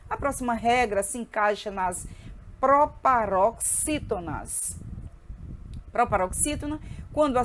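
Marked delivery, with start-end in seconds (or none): 2.6-4.8 "proparoxítonas" broken into drawn-out syllables; teacher introducing "proparoxítonas" very deliberately; slow syllable-by-syllable. Shown as a demonstration. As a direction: sound out "proparoxítonas" slowly, piece by piece. Teacher introduces the term slowly.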